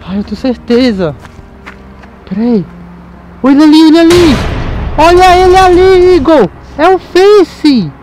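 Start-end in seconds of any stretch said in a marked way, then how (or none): 3.4-7.9 loud excited exclamation, higher. Real, not acted. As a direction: blurt it out, thrilled and loud. Spotting the missing friend.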